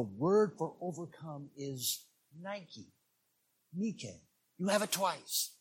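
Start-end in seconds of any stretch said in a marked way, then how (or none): 2.4-2.9 Nike said as "NYE-kee"; American pronunciation. Shown as a demonstration. Preacher naming the word for overcome, Nike as "NYE-kee". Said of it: "we call it Nike". 3.7-4.2 Nike said as "NEE-kay"; Greek pronunciation. Shown as a demonstration. Preacher correcting to the Greek for students, Nike as "NEE-kay". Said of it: "it's actually pronounced Nike".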